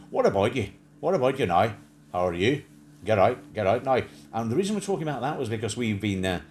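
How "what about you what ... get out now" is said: louder mock Northern Irish greeting; punchy put-on Northern Irish accent. Imitating people from Northern Ireland, in their accent. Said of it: "people who say".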